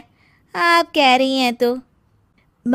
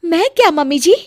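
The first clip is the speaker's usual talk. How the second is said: ordinary talk, nothing marked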